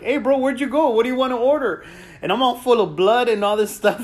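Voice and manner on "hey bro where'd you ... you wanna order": higher, oblivious bro voice; clueless friends. Retold or imitated. Taco shop friends calling out to him, higher clueless bro voice. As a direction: play the clueless buddies asking about food. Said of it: "these fools come out of the taco shop going like".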